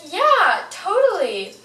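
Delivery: bright, eager agreement; eager yes. Retold or imitated. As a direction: gush yes like a keen teen. Eager reply to babysit request.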